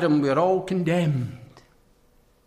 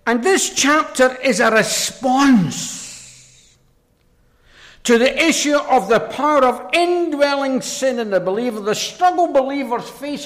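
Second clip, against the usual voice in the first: raised, ringing pulpit delivery; forceful preaching; 9 semitones higher, 9 dB louder than usual. Real, not acted. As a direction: preach it out high and loud.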